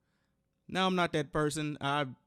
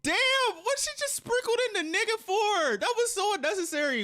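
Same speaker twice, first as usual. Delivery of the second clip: very high, louder shocked outburst; outraged disbelief; 4 dB louder than usual. Real, not acted. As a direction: shriek it in stunned outrage. Shocked reaction to slur.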